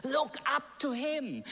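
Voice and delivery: normal talking voice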